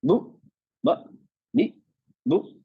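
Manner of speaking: बु, ब, बी each short, shut by a glottal stop; cut-off syllables. Shown as a demonstration. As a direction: separate each of बु, ब, बी with a closed throat. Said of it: "vocal cord बंद हो गई". (बु, ब, बी सब पर आवाज कटी हुई)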